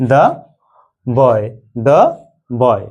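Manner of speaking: put-on "the boy" said as duh boy; THE before a consonant. Shown as a demonstration. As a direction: model "the" with a short open vowel, then "boy". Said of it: "এর উচ্চারণ কি হবে তাহলে".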